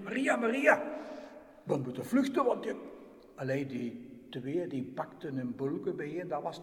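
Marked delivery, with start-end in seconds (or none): 0.0-2.7 urgent waking. Acted. Joseph wakes Mary urgently.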